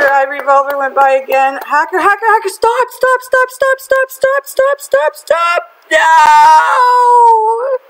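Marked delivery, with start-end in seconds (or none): 1.7-5.6 excited pleading. Real, not acted. Begging the spinner to stop. 5.9-7.8 disappointed shout. Real, not acted. The spinner just missed.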